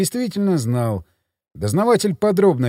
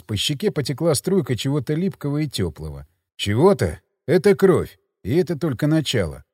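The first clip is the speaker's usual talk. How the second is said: normal talking voice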